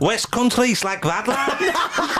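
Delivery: high, slow, badly faked West Country; laughing mock West Country lad, pitched high; West Country accent attempt. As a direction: attempt West Country but slide into Scouse. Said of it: "Do West Country".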